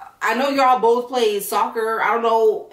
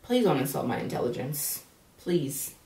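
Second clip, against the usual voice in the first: quiet scorn; 5 semitones lower, 8 dB quieter than usual. Real, not acted. Scoffing at Sammy's claim.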